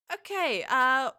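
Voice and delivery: loud, high character voice; high, loud hesitant role voice; wrong character voice. Acted. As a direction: start in a high, loud put-on voice. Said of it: "wait, I did the wrong voice".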